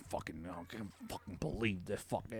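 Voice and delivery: soft, muttered swearing to himself; muttered curses. Retold or imitated. Walking up to the door, muttering curses to himself. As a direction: grumble under your breath.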